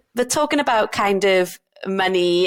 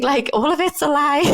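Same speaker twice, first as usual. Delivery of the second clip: higher-pitched, laughing exasperation; 7 semitones higher than usual. Real, not acted. Doctor dismissing the career promise, laughing. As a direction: laugh as you blurt it out. Laughs while calling it a lie.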